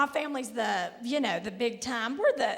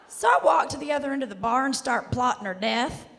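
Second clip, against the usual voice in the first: ordinary talk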